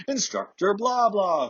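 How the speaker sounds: high, slow sing-out of a name; mock scream-out. Class leader calling out the next instructor, high drawn-out mock scream. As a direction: call it out long and high, imitating an announcer. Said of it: "would scream out".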